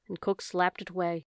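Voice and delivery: usual speaking voice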